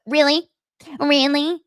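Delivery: high, loud mocking repeat; sarcastic parroting, high and loud; mocking really. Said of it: "When people start the mocking".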